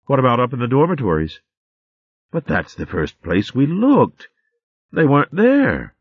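lightly voiced excited maids; young squirrel maids. Friends discuss search.

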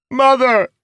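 very high, loud anguished cry; anguished shout. Acted. Son crying out over his dead mother, anguished shout. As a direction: scream it out in grief. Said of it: "he shouted".

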